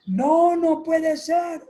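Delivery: high, drawn-out disbelieving protest; negación. Acted. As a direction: act out a son refusing to accept it, slowly. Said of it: "negación". (negación aguda y alargada)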